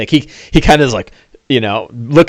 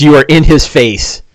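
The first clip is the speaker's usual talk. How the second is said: normal talking voice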